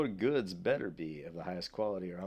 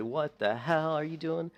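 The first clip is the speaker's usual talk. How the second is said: higher, drawn-out scolding; mock scolding yell; 7 semitones higher, slower than usual. Shown as a demonstration. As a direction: scold with slow, stretched words and raised pitch. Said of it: "you need to yell at me".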